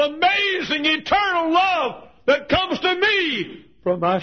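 high, loud, slow testimony; full-force preaching. Acted. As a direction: build it to a cry of praise.

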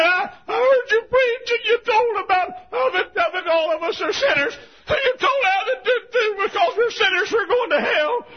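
very high, loud tearful outpouring; crying, shouted. Retold or imitated. Ruth to preacher about his sermon: loud high crying. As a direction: sob it out, pushing through. Woman retelling sermon in tears.

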